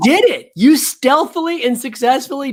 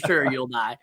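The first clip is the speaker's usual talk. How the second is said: low, soft, dry retort; skeptical humoring; 8 semitones lower, 8 dB quieter than usual. Retold or imitated. Teller replaying his answer to a friend's fear, skeptical. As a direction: brush him off quietly, pitched down. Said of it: "And I'm like".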